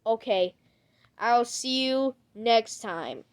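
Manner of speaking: ordinary talk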